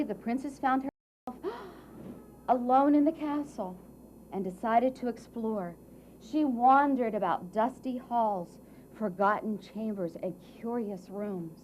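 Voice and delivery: ordinary delivery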